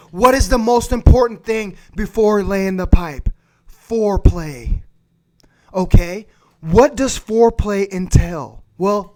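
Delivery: ordinary talk, nothing marked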